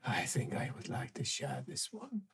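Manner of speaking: low menacing whisper. Acted. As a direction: breathe it out in a sly whisper. Creature whispers.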